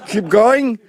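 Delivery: mock director calling out; loud directing call. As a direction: urge the performer on loudly. Directing the parodied candidate.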